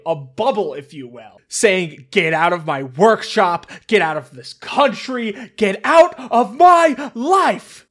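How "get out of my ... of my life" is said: loud, rising shout, louder on the last line; angry yell. Acted. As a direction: throw him out, yelling. Hope yells at Snively.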